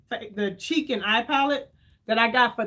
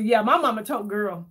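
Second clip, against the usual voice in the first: ordinary talk, nothing marked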